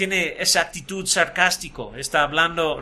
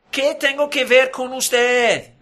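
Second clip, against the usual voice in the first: higher, slower, dismissive; sarcastic retort; 5 semitones higher, slower than usual. Retold or imitated. Eliseo dismissing the king of Israel, sarcastic and higher. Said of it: "puedes escuchar su actitud".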